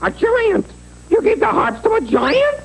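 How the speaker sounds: high, aghast double-take; can't believe what he's hearing; shocked disbelief. Acted. Jack learning the goose gave harps to a giant, high shocked cry. Horrified at harps given away.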